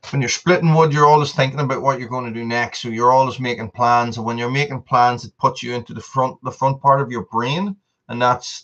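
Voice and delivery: ordinary delivery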